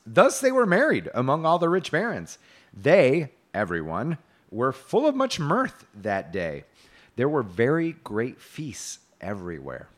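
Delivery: loud, measured storybook reading; grand tale narration. A narrator's reading. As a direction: declaim it like an old chivalric romance. Reads medieval tale aloud.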